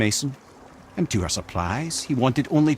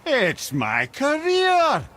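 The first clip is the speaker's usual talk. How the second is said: drawn out and much higher, mock indignant; indignant exclamation; 13 semitones higher, much slower than usual. Mimir answering the boy about Odin, slow indignant protest. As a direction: throw it back as if it's obvious.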